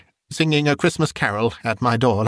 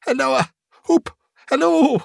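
very high, sustained hoots; whooping calls. A narrator's reading. Scrooge calls after Friday as he runs, whooping. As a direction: halloo gleefully in a high, ringing pitch.

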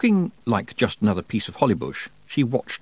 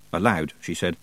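ordinary delivery

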